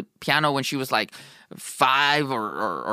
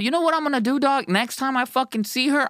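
friend hyped up, voice jumping higher; higher, excited declaration; 8 semitones higher than usual. Retold or imitated. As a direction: announce it like a bold dare. Friend announces he'll tell her.

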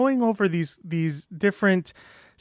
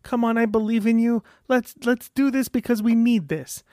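higher, cheery pep-talk voice; upbeat, higher self-encouragement; encouraging inner voice; 6 semitones higher than usual. Retold or imitated. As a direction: cheer yourself on like a friendly buddy. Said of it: "most people have this little voice that's like".

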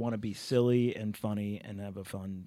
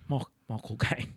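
clumsy Cockney-ish Michael Caine; bad Michael Caine impression; 3 dB quieter than usual. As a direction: stumble into a Caine voice. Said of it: "Your impression is so bad".

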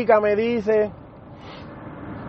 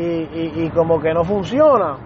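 ordinary delivery

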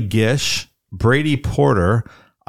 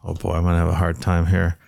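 usual speaking voice

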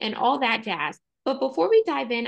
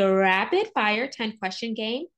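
"rapid" hit harder than the words after it; stress on rapid. Shown as a demonstration. Host offers guest a quick-question game, stress on "rapid". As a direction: punch the word "rapid". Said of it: "emphasis on rapid".

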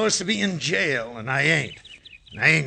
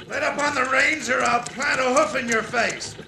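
high ventriloquist's talking-horse voice; thrown horse voice; 8 semitones higher than usual. Shown as a demonstration. Ex-convict showing off ventriloquism, as a talking horse. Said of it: "how to throw my voice like this".

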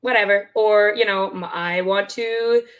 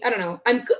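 ordinary talk

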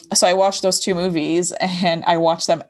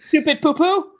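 put-on childish insult; 9 semitones higher, 3 dB louder than usual.

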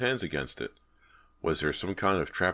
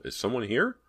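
higher questioning call; tentative call rising in pitch; 8 semitones higher than usual. Acted. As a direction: call into an empty hall. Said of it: "he called".

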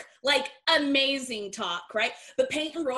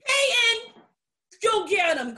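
excited cheer; 9 semitones higher, 7 dB louder, slower than usual. Real, not acted. Cheering on wrestlers.

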